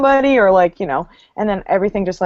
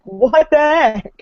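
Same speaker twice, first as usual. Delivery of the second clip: mock disbelief; 7 semitones higher, 3 dB louder, much slower than usual. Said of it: "And I was like".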